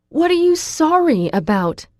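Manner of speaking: high, loud, demanding shout; girl shouting back across the hallway. A narrator's reading. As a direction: shout it back without caring who hears. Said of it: "I shout back".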